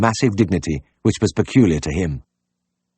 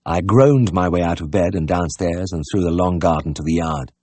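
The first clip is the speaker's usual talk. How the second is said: ordinary delivery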